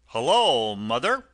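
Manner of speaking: loud, hearty workman's greeting; hearty engine driver. Acted. Burly driver calling to the washerwoman, hearty and loud. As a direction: call out cheerily like a burly driver.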